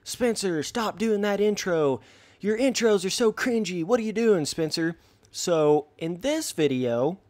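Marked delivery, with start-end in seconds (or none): 0.0-5.0 higher-pitched mock complaint; put-on higher voice of a critic; complaining commenter. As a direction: imitate a commenter whining about cringe. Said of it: "people will say stuff like".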